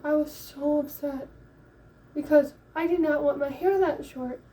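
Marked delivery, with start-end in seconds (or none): none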